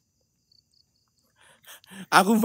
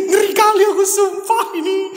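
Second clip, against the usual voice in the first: nervous laughter; 12 semitones higher than usual. Real, not acted. Explorer laughing nervously at the scary height. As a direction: laugh through the fear. Terrified laughing on a high floor.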